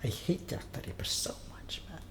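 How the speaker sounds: a hissed, bitter confession; hateful whisper. Real, not acted. Vlogger whispering his hatred of Jack the Ripper, hateful whisper.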